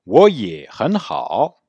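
我也很好 very slowly, each tone drawn out; slow demo. Shown as a demonstration. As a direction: stretch every syllable for students. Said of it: "le faire lentement".

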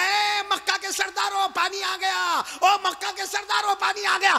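high, loud announcing shout; loud call. Acted. A man shouts to the chiefs that rain has come. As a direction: shout the news across the square. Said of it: "जोर से कहने लगा".

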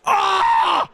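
loud, high shouted "ah"; sudden high cry at the play; startled yell. Real, not acted. Reacting to play in game.

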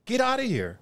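very high squeaky sneer; mocking tough guy. Bouncer mocking the gang who cussed out Rico. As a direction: ham up the bully's bluster.

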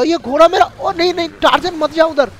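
high-pitched, urgent "no, don't go"; alarmed calling out. Pretend. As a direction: call your horse back urgently. Calling his horse back from danger.

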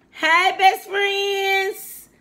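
high, loud, very slow call to best friends; excited greeting. Real, not acted. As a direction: call out to the viewers with delight. Greeting viewers loudly.

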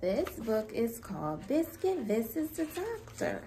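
ordinary talk, nothing marked

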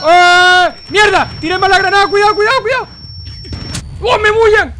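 high panicked shouting; grenade warning yell. Real, not acted. Botched grenade, warning teammates.

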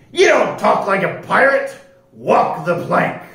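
loud pirate order; bellowing pirate. A narrator's reading. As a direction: bellow the verdict, slowing on the sentence. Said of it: "shouted Pete".

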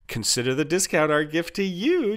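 high-pitched beaming salesmanship; audibly smiling, high helper voice. A narrator's reading. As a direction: present it proudly as a gift. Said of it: "Jarvis beamed".

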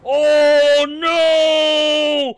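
very high, loud cry of grief; horrified wail. Real, not acted. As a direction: wail in disbelief at the loss. The sister is killed too.

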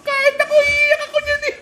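very high squeal through laughter; laughing taunt. Real, not acted. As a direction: squeal it out, cracking up. Humahalakhak sa pagkatalo ng kalaban. (tumitiling tawa habang nang-aasar)

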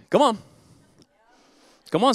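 normal talking voice

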